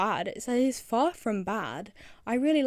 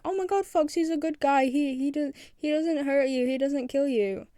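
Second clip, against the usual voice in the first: higher, louder mock fan gushing; mock-excited fan voice, pitched up; imitating gushing fans; 5 semitones higher, 5 dB louder than usual. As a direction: play the excited, naive fans. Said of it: "people were like".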